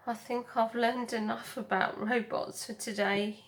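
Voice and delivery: ordinary delivery, nothing marked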